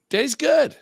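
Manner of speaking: squeaky high-pitched pleased voice; silly high voice. Pretend. To his friend, bragging he's fine after drinks, in a squeaky high voice. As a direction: squeal it out like a cartoon character.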